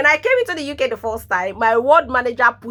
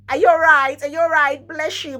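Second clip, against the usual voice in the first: high, louder sing-song greeting; mock British greeting; 6 semitones higher, 5 dB louder than usual. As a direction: parody the sweet greeting. Mocking neighbour's greeting.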